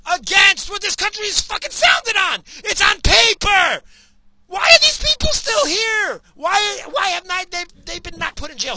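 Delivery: yelling in real outrage at the government; real furious rant. Real, not acted. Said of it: "Yeah, I'm worked up".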